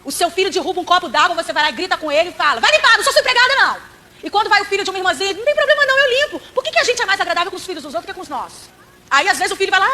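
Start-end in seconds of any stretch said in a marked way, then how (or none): 2.6-3.8 high, louder irritated snapping; scolding mother. Acted. Mãe gritando com o filho. 5.3-6.4 much higher, honeyed fake-nice voice; sugary sweet churchgoer. Mãe gentil com filho alheio.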